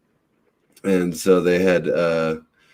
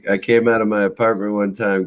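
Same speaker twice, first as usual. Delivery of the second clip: ordinary talk, nothing marked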